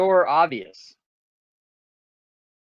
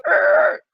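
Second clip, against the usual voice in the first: high, loud war cry; battle yell; 7 dB louder than usual. Retold or imitated. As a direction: roar it out as you strike. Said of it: "and then go".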